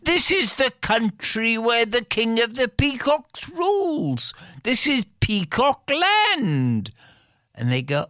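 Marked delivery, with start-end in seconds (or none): 0.0-6.9 higher, proud announcement, slowing at the end; proud locals. Acted. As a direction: present Peacock Land proudly. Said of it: "everybody says".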